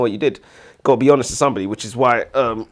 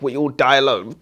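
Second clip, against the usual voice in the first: usual speaking voice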